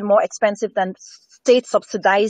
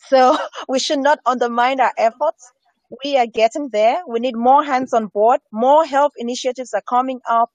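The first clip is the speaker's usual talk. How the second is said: ordinary talk, nothing marked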